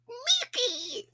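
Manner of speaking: high squawked Donald Duck call; failed Donald Duck impression. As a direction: squawk out the name like the cartoon duck. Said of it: "Wait, let me try to do it".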